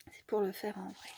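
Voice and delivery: whispered aside; soft whispered repeat. Real, not acted. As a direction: breathe it like a confession to the viewers.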